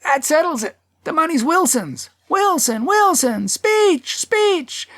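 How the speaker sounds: very high, excited crowd cries; crowd clamoring. A narrator's reading. Fifty voices hail the winner, high clamoring. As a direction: chant it high like fifty people calling. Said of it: "Fifty voices".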